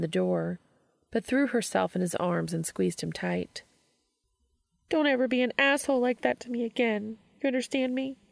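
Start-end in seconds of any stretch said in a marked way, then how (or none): 4.9-8.2 higher voice, tearful but serious scolding; teary friend. Acted. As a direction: hold back tears while laying down the law. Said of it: "muffled in his chest and more than a little teary".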